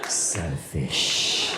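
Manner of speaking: very low, drawn-out mock condemnation; disgusted verdict. Diner left paying the bill, low disgusted verdict. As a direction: draw it out low with mock contempt. Comic judges friend who took offer.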